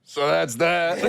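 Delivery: slow, put-on German-accented sign-off. Turning the camera back after he leaves, German accent. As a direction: wrap up slowly in a German accent. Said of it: "send them a video in this accent".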